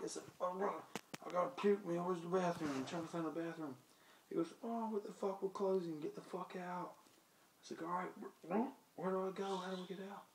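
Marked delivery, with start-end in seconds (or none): 0.4-2.7 high, loud pleading act; retold frantic plea for a bathroom; faked urgent nausea. Feigns urgent nausea to bouncer. 4.6-6.9 high, exasperated mock order; raised-voice impression of fed-up staff; retold angry bouncer. 7.8-10.3 high, urgent mock confusion; retold flustered plea. Flustered drunk asks way out, retold.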